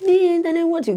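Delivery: high, loud mocking whine; mocking whiny child. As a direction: mimic the pupil's whine back at him. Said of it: "I was like".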